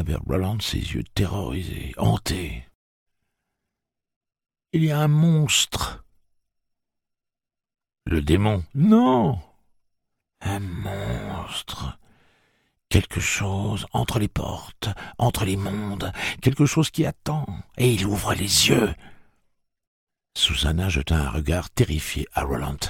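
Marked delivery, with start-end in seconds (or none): 4.7-6.0 haunted dread. Acted. Eddie haunted, terrified. 8.1-18.9 haunted trance. Acted. Eddie entranced, terrified.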